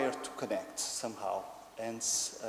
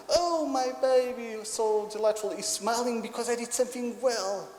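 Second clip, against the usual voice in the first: delighted mother; 10 semitones higher, 6 dB louder than usual. Acted. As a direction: gush over your baby, high and loud. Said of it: "the mother usually says".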